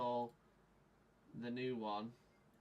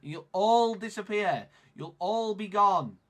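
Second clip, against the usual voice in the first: loud, high lament; despairing outburst; 6 semitones higher, 10 dB louder than usual. Real, not acted. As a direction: cry out that all is lost. Dramatic dismay about losing viewers.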